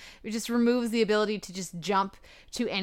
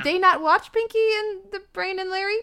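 high, loud disbelief; shocked fan asking if they watched the show; incredulous; 12 semitones higher, 5 dB louder than usual. Retold or imitated. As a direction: squeal it in disbelief. Incredulous retold reaction.

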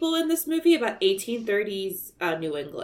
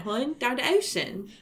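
thick Baltimore vowels; Baltimore accent; 4 semitones higher, 4 dB quieter than usual. Shown as a demonstration. Maryland native demonstrates local speech, Baltimore accent. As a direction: lay on the local Baltimore twang. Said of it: "It's a Baltimore accent".

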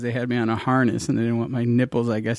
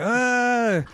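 hammed-up caught-out dad, voice climbing; mock stalling; 8 semitones higher than usual. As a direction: stall for time in a raised pitch. Acting dad caught out, improvising excuse.

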